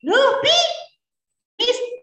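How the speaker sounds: put-on high, curious child's voice; a boy pestering his father with questions. Young Ismail asking his father about the dream in a high child voice.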